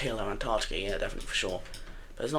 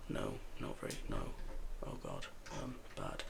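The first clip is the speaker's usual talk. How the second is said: a mock growl, low and much softer, lightly done; odd low voice; 14 dB quieter than usual. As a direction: drop into a strange low voice as a joke. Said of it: "What was that low-pitched voice?".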